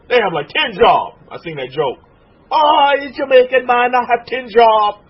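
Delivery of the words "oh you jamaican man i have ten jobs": loud, higher mock Jamaican accent. Preacher quoting a joke about ten jobs, Jamaican accent. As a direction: belt it out in a joking Jamaican accent. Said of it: "She's working like the Jamaicans".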